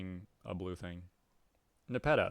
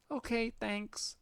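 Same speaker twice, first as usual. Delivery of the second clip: put-on much higher, childish defiant cat girl; 13 semitones higher than usual. Cat girl refusing the troll's order, high and childish. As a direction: squeak back like a defiant kitten.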